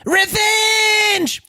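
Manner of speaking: high shouted squeaky war cry; tiny slime battle cry. Shown as a demonstration. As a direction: squeal it like a little blorp. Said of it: "One more time".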